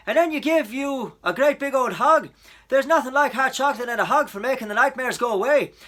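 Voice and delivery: loud, hearty, reassuring officer; reassuring policeman. A narrator's reading. Policeman prescribes hot chocolate and a hug, loud, reassuring. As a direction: soothe a child in a big officer's voice. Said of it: "He had a deep, reassuring voice".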